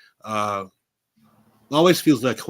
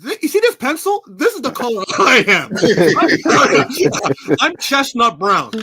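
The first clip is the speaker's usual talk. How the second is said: laughing explanation; 6 semitones higher, 9 dB louder, slower than usual. Retold or imitated. Kid self explaining color.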